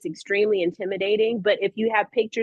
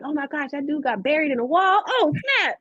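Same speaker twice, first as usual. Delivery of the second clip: higher, mock-amazed gasp of a reader; imitated shocked reader; 6 semitones higher than usual. Student reacting to the comic's twist, mock shocked and high. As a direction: play a kid stunned by the twist, rising to a squeal.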